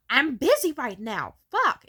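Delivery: loud, high, exasperated shout; angry mother. Retold or imitated. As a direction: yell it like a mother fed up with interruptions. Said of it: "And then she got angry".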